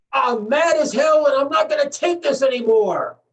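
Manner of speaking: acted defiant yell, not full volume; rallying yell. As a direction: yell it out the window in outrage, pitch held high. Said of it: "I want you to yell".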